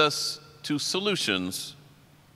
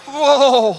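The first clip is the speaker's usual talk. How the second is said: very high loud startled whoa; a big startled whoa; mock shocked whoa; 11 semitones higher, 9 dB louder than usual. Imitating his own alarm on stage, high whoa. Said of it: "I'm sitting there going".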